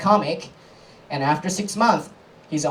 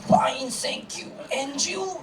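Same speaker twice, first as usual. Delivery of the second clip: weak, high, strained voice of someone about to puke; sick, reflexive answer; 7 semitones higher, 6 dB quieter, slower than usual. Acted. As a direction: squeeze out a polite reply while nauseous. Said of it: "He reflectively answered".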